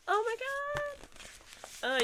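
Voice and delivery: high, long drawn-out squeal of delight; delighted gasp. Real, not acted. Unboxing a pretty book for viewers, squealing in delight. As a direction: melt over something gorgeous.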